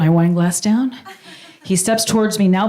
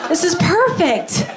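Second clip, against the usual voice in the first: swooning; 7 semitones higher, slower than usual. Retold or imitated. Dreamy swooning at date.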